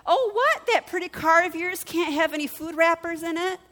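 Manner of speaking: high-pitched, taunting mock question; mocking zinger. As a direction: needle someone with an imagined comeback. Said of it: "I wanted to be like".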